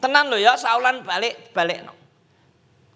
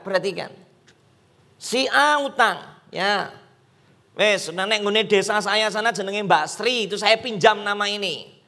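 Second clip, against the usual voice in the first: usual speaking voice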